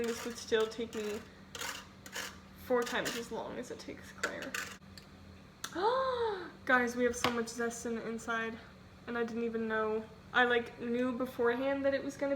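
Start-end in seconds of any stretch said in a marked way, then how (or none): 5.6-6.6 sharp high-pitched gasp; surprised gasp. Real, not acted. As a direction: gasp in sudden discovery. Surprised at finding more zest.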